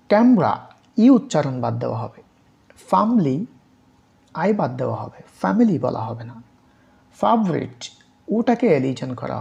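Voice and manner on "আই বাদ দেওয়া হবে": ordinary talk, nothing marked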